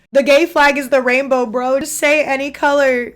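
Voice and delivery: a loud, high, exasperated plea; exasperated call. Real, not acted. Streamer to chat, exasperated and loud about the flag colors. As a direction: throw up your hands and plead.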